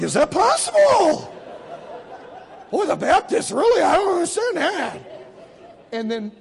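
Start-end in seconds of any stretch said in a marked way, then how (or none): 0.0-5.0 loud, high, hammed-up bafflement; astonished disbelief. Israelis reacting to Jim's joke answer, mock astonishment. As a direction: imitate two stunned men exclaiming to each other. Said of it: "They looked at each other like".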